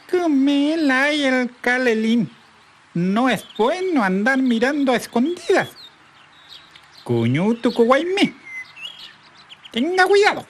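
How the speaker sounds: high, warning bird voice; scolding partridge. A narrator's reading.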